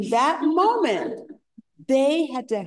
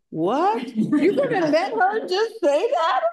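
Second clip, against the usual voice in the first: incredulous siblings; 10 semitones higher than usual. Retold or imitated. Siblings incredulous at her calm.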